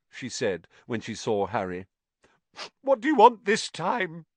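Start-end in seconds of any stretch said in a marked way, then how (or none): 2.8-4.3 Myrtle. Acted. Myrtle grumbling at Harry.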